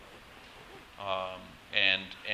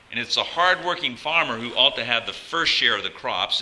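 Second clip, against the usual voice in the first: scripture reading; 7 dB louder than usual. Acted. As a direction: proclaim the verses like a preacher.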